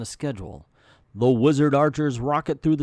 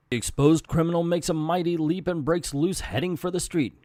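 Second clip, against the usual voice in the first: plain reading voice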